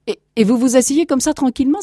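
high, loud stammered protest; incredulous exclamation. Acted. Captain to the calm grandson, incredulous outcry. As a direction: exclaim in disbelief, pitched high. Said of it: "s'écria le capitaine".

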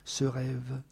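very soft, low musing; hushed, trailing murmur. A narrator's reading. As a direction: murmur it to yourself. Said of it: "murmura-t-elle".

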